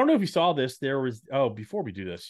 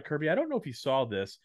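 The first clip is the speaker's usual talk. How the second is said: ordinary talk, nothing marked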